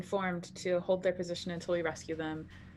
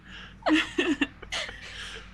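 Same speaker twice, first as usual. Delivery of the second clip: breathy laugh; 9 semitones higher than usual. Real, not acted. Laughing at joke.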